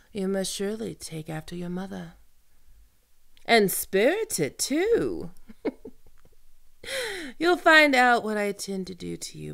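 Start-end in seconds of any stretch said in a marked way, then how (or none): 5.4-7.3 high wicked laugh. Acted. Villain laughs at captive.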